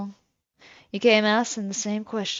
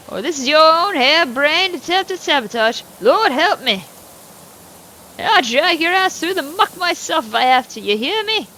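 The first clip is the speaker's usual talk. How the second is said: put-on a loud, higher-pitched cantankerous old farmer; gruff old farmer; 5 semitones higher, 6 dB louder than usual. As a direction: play the surly old landowner, talking down to the neighbor. Plays Mister Morgan.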